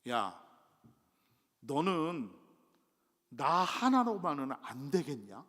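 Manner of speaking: put-on a patient father chiding his child; slow, gently stern divine question; God's voice. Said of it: "그 똑같은 말씀이 뭔지 아세요".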